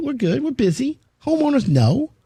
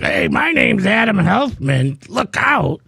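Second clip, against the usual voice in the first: slow, pompous big-shot announcing himself; mock grand self-introduction; 5 semitones higher, slower than usual. Host mocking a self-important entrance to contractors, slow and pompous. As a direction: play the boastful celebrity walking onto the site. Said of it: "And I don't walk into the project".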